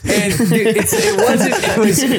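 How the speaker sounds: high, laughing through the words; real giggling, halting and breaking up; helpless laughter. Real, not acted. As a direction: try to get the story out while cracking up. Teller cracks up after punchline.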